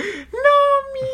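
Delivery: sharp high hammed-up gasp; mock panicked gasp. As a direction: gasp like a terrified parent hearing bad news. Acting a panicked parent on phone.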